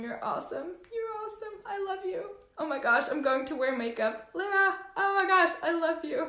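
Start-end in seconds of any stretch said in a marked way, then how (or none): 0.9-2.2 high, soft, choked-up voice; small, high, loving reply; tearful affection. Real, not acted. 4.3-6.2 high, gushing and tearful; high-pitched heartfelt outpouring; moved affection. Real, not acted. Moved reply to a viewer.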